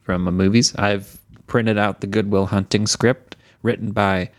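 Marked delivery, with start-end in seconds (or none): none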